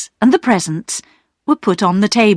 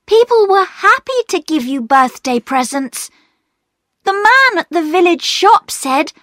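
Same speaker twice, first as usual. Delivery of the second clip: put-on higher pleading girl's voice; earnest young girl; 9 semitones higher than usual. A narrator's reading. Girl explains gifts to Perks, earnest pleading. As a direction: convince him gently.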